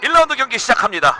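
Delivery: excited call. Real, not acted. Commentator calls round start.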